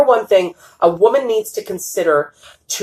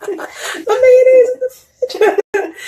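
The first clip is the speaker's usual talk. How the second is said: high, slow words squeezed out through laughter; laughing; much slower than usual. Real, not acted. Host cracking up at the guest's mayonnaise joke, laughing. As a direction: laugh your way through the phrase.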